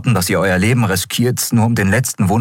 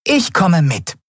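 tiny determined creature; 12 semitones higher, slower than usual. Acted. Nelen announces she joins.

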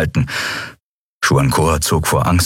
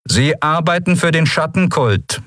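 sober accusation, higher-pitched; matter-of-fact; 10 semitones higher than usual. Acted. Vice commissar accusing the prisoner of cult work, matter-of-fact. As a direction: state it soberly, as established fact. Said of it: "stellte Monaro nüchtern fest".

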